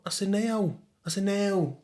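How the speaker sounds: Sheffield "now" for no, vowel opened into now; Sheffield accent. Shown as a demonstration. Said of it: "Man, a Sheffield boy".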